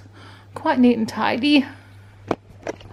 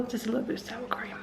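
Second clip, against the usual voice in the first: whispered aside to herself; whispering; 12 dB quieter than usual. Real, not acted. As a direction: breathe it almost silently. Muttering to herself while cooking.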